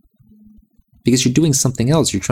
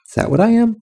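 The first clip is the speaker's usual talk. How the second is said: incredulous; 12 semitones higher, faster than usual. Retold or imitated. Retelling his own baffled reaction.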